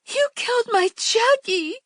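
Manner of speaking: wife shrieking over her husband on the ground. A narrator's reading. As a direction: wail it like a hysterical wife. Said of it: "she shrieked".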